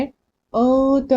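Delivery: अंत with the n held as a long nasal hum before the t; noon hidden in nose. Shown as a demonstration. Teacher showing how to hide the noon, nasal hold. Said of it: "नाक में छुपाएंगे".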